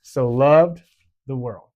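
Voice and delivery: slow, stretching "loved" for the toddlers; slow with motions. Shown as a demonstration. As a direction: stretch out "loved" for the little ones. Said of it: "it goes like this".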